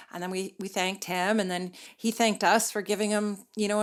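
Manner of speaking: ordinary delivery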